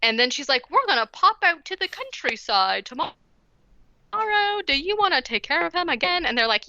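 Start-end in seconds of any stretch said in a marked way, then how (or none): 0.7-6.3 high, hammed-up British aunt; mock a corny British accent, pitched up; terrible British accent. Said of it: "the worst British accent of all".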